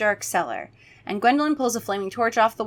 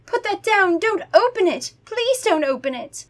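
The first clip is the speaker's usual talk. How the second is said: much higher pleading voice; begging vampire; 10 semitones higher than usual. Acted. Gwendolyn begging Freddy not to open it. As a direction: plead in a hammed-up panic. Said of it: "Gwendolyn begged".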